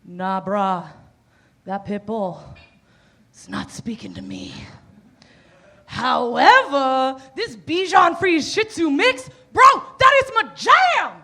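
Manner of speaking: dude-bro voice, whispered then shouting; frat bro. Retold or imitated. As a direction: play a hyped bro falling for a tiny dog. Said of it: "been like".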